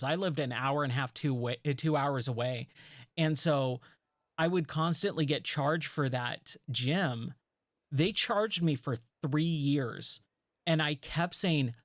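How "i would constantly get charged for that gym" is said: ordinary talk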